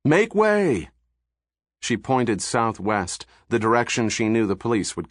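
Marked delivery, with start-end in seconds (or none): 0.0-0.9 high ringing yell over the crowd. A narrator's reading. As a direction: call it out to the crowd. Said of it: "she yelled".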